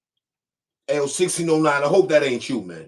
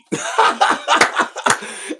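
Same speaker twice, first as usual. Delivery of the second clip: high breathy laugh; 11 semitones higher than usual. Real, not acted. Laughing at his own roast.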